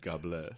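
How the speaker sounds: "God" said as "Gah", with no D; D left off. Shown as a demonstration. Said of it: "you gotta leave off the D and God".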